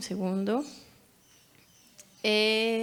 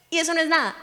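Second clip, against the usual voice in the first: ordinary delivery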